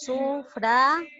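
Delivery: Sufragio slowly split Su, FRA, gio; syllable-by-syllable stress demo. Shown as a demonstration. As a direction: break Sufragio apart, leaning on FRA. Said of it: "A ver, analizamos".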